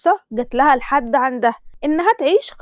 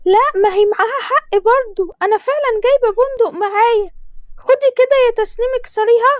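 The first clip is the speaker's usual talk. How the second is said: groom's mother blessing; 10 semitones higher than usual. Acted. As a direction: lay the blessing on thick, pitched up. (حماة بتبارك بصوت عالي ومبالغ)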